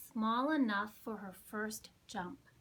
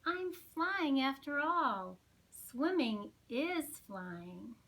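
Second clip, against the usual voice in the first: slow wonder; 2 semitones higher, slower than usual. Acted. Pip's joyful realisation.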